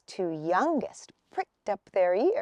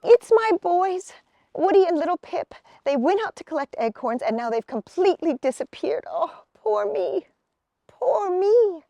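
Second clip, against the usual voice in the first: high, louder lament; distressed mother; 8 semitones higher, 4 dB louder than usual. Acted. Missus Acorn lamenting her missing sons, high and upset. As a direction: wail about your lost boys.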